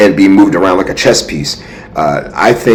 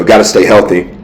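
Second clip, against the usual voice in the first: usual speaking voice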